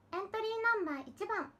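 high, loud cutesy idol voice; your cutest voice possible. Pretend. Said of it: "自分が出せる最大の可愛い声".

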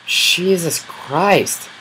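high, slow drawn-out groan of disbelief; real exasperation; exasperated. Real, not acted. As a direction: stretch it out in exasperation. Another mob appears.

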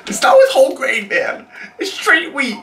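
high, fast, squeaky protest; squeaky exasperation. Acted.

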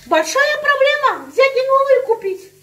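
high, slow mocking imitation of the husband. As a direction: parody his shrug it off attitude. Wife mocks husband's dismissive line.

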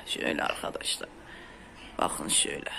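hushed whisper to the viewers. Real, not acted. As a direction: whisper as if sharing a secret. (fısıltıyla)

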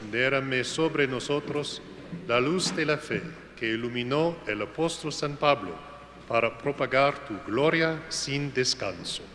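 usual speaking voice

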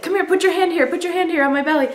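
high-pitched urgent beckoning to husband; eager beckoning. Retold or imitated. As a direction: call him over excitedly in a high voice. Calling husband over to feel belly.

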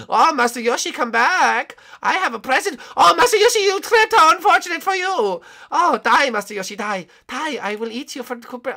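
very high, loud cartoon voice; shrill put-on animatronic killer; gleeful villain character. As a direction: greet your prey with mock delight. Plays the animatronic hunter.

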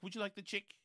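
imitated New Zealand accent, check as "chick"; New Zealand vowels, "check" turned into "chick". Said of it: "that accent".

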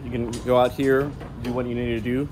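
ordinary talk

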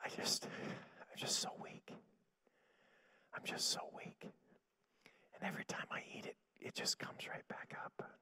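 imitating a drained, feeble whisper; barely able to talk. Preacher acting out a sick sister too weak to speak, feeble whisper. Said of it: "she could barely talk. She had no energy".

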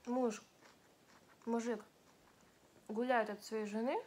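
chewing while talking; speaking around food; mouth full. Real, not acted. Seen 1: Cheeks bulge with food, jaw chewing through most words, sushi before her.

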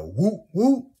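high, loud whooping call; high loud hype call to fans; excited whoop. Real, not acted.

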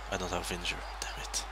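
low whispered complaint; soft whispered grumble. Real, not acted. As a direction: whisper it in frustration. Frustrated aside while playing.

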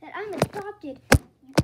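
high, loud indignant outburst; outraged. Acted. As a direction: protest in disbelief, pitch jumping up. Outraged at learning of the adoption.

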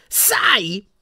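high, loud, overpowering cut-in. A narrator's reading. Portrait shouting Harry down, angry yell. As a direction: shout him down in one word. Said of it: "urlò ancora più forte Phineas Nigellus".

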